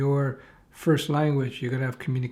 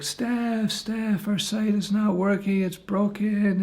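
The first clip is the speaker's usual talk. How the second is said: panicked friend; 7 semitones higher, much slower than usual. Retold or imitated.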